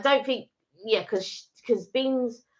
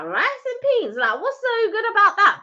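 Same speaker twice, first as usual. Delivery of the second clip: much higher disbelieving "Rice and peas?"; incredulous question; 11 semitones higher than usual. Retold or imitated. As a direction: act baffled by "Rice and peas?". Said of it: "and you're like".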